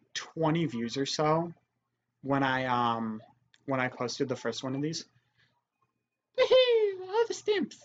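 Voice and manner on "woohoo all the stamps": very high cheering voice; weird voice. Acted. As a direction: squeal it in a silly voice. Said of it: "I do weird voices sometimes".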